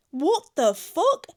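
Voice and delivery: slow, loud, high incredulous question; drawn-out outburst with a rising end; appalled disbelief. Real, not acted. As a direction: stretch each word out, aghast. Appalled reaction to the confession.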